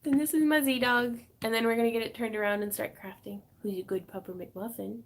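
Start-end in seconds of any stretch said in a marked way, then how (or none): 3.6-5.0 talking to a dog. Real, not acted. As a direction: coo softly at the dog. Talking baby talk to her dog.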